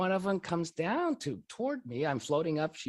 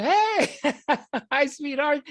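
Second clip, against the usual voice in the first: much higher, louder joyful hello with a laugh; happy greeting; 10 semitones higher, 4 dB louder, slower than usual. Retold or imitated. Floating husband greets his wife below, thrilled and high. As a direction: greet her like you're thrilled to see her. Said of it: "I look at her and I'm so happy".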